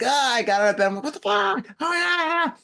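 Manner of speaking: high-pitched mock panicked yelling; freaking out. Man retelling being burned on his back, freaking out. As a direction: reenact jumping up in shocked pain, voice shooting up. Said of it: "You know, I'm freaking out".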